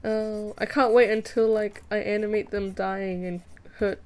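ordinary talk

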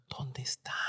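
unvoiced frightened whisper; hushed scared whisper. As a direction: whisper so they won't hear. Hushed question in bathroom doorway.

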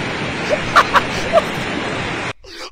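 airy squeal of a laugh, high up; high breathless laugh. Real, not acted. Laughing at the flood reporter's singsong voice. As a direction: burst out laughing in disbelief.

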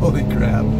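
startled, loud burst of amazement; surprised exclamation. Real, not acted. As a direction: blurt it out in shock. Shocked by sudden throttle kick.

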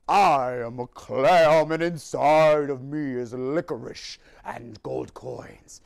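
low slow clam voice; deep, drawn-out clam creature. Acted. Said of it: "That's my clam voice".

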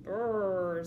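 loud, low rolled "brrr"; shivery "brrr". A narrator's reading. Badger tromps inside from the cold wind, loud shivering noise. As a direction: burst in with a big cold "brrr".

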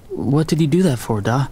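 put-on hurt, plaintive childlike plea; plaintive child. A narrator's reading. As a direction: ask it like a wounded child. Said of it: "I heard the plaintive child in my voice".

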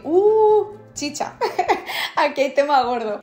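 un "Uh" agudo y fuerte de sorpresa, luego entre risas; sorpresa contada. Retold or imitated. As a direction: imitando su propio asombro al ver el comentario. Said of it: "Entré porque dije". (al ver la notificación, exclamación de sorpresa con risa)

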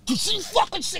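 high, pushy accusing voice; mock angry confrontation. [name] storming up backstage, mock angry and high. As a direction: come at him like you're about to grab him.

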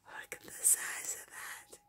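very soft, voiceless wonder; awed whisper. Real, not acted. Marvelling at the tiny size, awed whisper. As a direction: whisper in amazement.

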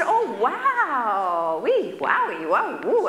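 high playful oohs and wows; mock amazement. Doctor teasing patient over cookie, high mock wonder. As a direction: ham up fake wonder. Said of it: "So he said".

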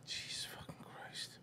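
exasperated whisper. Real, not acted. Exasperated aside on the clip.

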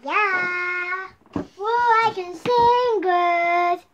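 high, loud sung-out helium squeal. Real, not acted. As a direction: sing it out high and drawn-out on helium. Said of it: "Helium voice".

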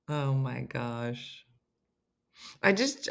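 ordinary delivery

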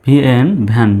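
ordinary delivery

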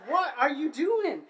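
stunned disbelief, drawn out; asking a friend dressed like Rambo, astonished; incredulous shout. Retold or imitated.

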